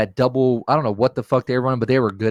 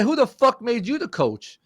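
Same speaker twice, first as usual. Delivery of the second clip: high-pitched challenge; retold incredulous outrage, pitched up; indignant comeback; 11 semitones higher than usual. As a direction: fire back in a much higher voice, re-enacting it. Acted comeback to the teammate.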